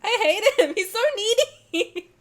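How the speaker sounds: high, loud, laughing exasperated rant at the screen; frustrated fangirl outburst. Real, not acted. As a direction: squeal it out, half laughing and half fed up. Fangirl outburst over game character.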